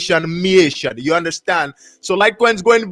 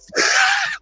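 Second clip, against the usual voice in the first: high squeal of laughter; 3 dB quieter than usual. Real, not acted. Biting a rock-hard donut.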